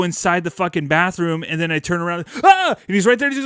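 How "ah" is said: much higher and louder short scream; startled scream. Retold or imitated. Kid finds dad behind him in bathroom, startled scream.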